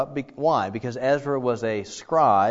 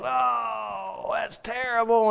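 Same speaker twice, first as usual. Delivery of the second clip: mock wail; 9 semitones higher, much slower than usual. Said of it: "he starts to cry and wail".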